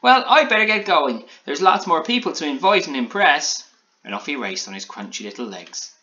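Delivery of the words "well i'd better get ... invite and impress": high, loud goodbye; bustling gingerbread man. A narrator's reading. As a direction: announce your exit in a raised character voice. Alan hurriedly takes his leave.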